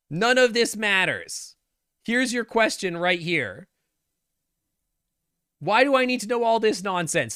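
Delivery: ranting. Real, not acted. Ranting at the quiz.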